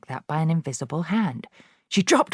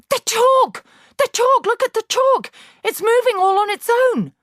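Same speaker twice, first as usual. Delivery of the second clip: put-on very high shrill child's scream; hysterical scream; 7 dB louder than usual. A narrator's reading. Pupil screaming at the moving chalk, shrill. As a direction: shriek in terror, pointing. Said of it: "hysterical and shrill was Nigel's scream".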